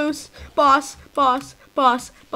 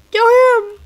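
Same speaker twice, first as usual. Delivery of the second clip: higher, louder command; urgent yell; 9 semitones higher, 6 dB louder than usual. Real, not acted. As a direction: shout it at the screen. Urging the kill, louder.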